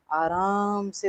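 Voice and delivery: आराम drawn out long and soft; slow, lingering आराम to show ease; unhurried. Shown as a demonstration. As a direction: stretch the first word, आराम, slowly and gently. Acting out Baba's unhurried gaze.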